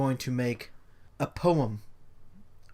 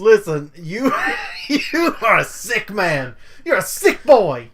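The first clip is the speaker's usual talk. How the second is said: laughing; 5 semitones higher, 7 dB louder than usual. Real, not acted. Laughing outrage at friend.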